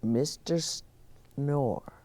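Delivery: plain reading voice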